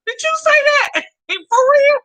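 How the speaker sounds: high, laughing did you say that; incredulous, barely holding back laughter; laughing disbelief. Retold or imitated. To ex who made an ultimatum, high laughing disbelief. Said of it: "I looked at him like".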